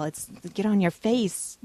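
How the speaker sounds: usual speaking voice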